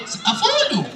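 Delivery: ordinary delivery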